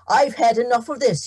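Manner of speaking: a farmer who has lost his patience; angry farmer. Acted. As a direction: seize him and bark it out. Tom shaking the leprechaun.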